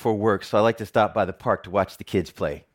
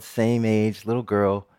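normal talking voice

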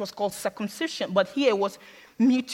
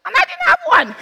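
laughing punchline; 9 dB louder than usual. Real, not acted.

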